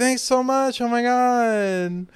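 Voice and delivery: high, slow gushing Thor voice; Thor imitated. Imitating Thor thanking Stormbreaker, high and gushing. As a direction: gush up high like a smitten boyfriend. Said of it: "And he's like".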